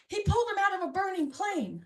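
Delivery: blurting it out excitedly; excited mom. Retold or imitated. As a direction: mom bursts in with the news. Mom exclaiming.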